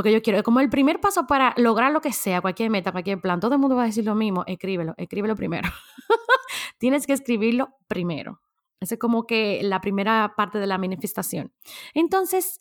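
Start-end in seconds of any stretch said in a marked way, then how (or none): 6.0-6.7 short rising laugh; a light laugh at the cliché; quick laugh. Real, not acted. Vlogger laughing at advice everyone repeats, quick laugh.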